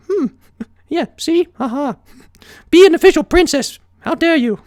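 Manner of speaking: high, haughty ladylike pony; Rarity justifying her crush; fancy pony. A narrator's reading. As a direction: speak like a dramatic lady.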